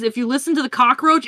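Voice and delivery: ordinary talk, nothing marked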